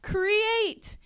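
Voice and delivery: high, loud commanding cry; imitated yell. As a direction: call it out like a stage creator. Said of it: "he starts yelling".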